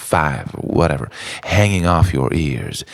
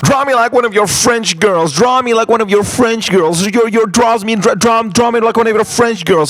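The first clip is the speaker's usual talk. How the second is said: much higher, louder hammed-up seductive voice; mock movie quote; 5 dB louder than usual. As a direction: camp it up like a swooning movie heroine. Repeated movie quote, put-on voice.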